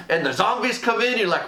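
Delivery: ordinary talk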